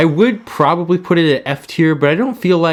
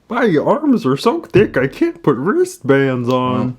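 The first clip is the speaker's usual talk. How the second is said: Kermit-like frog voice. Acted. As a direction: play a goofy puppet frog. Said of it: "Girthy the Frog here".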